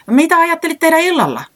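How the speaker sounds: high, loud and quick-fired question; hurried question. A narrator's reading. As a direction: blurt it out in a rush. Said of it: "se kysyi kiireesti". (korkealla ja kovaa kiireesti kysyen)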